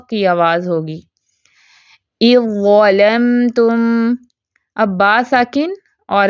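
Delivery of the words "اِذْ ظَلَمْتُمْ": slow recitation, ذ fully merged into ظ in اِذْ ظَلَمْتُمْ; tajweed recitation. Shown as a demonstration. Teacher shows correct reading of idgham, slow recitation. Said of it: "ذال کی کوئی آواز پہچان باقی نہیں".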